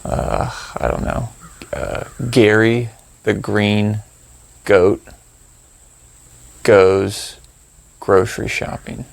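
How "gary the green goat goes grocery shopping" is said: slow phrase with pauses between words. Shown as a demonstration. Meditation guide making up a random phrase for listeners, slow and halting. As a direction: spell the phrase out slowly, pausing after goat and goes.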